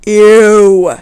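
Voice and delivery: loud disgusted ew. Real, not acted. Reacting to the creepy suitor with loud disgust. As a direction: recoil with a loud ew.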